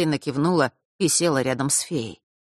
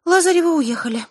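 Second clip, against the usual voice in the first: sighing; 14 semitones higher, 5 dB louder than usual. A narrator's reading. Diana tells friends the family has left, high sighing voice. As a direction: sigh out the news in a high voice. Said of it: "вздохнула Диана".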